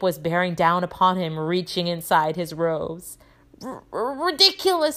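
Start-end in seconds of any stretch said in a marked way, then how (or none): 3.6-5.0 high stammered squeak; Neville. A narrator's reading. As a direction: squeak it out, tripping on the first sound. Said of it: "squeaked Neville".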